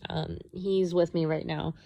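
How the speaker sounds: ordinary delivery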